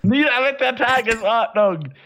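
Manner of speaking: high, slow rallying cry; fiery revolutionary speech. Acted. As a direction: shout it like a rebel leader. Revolutionary slogan. (feurige Revolutionsrede)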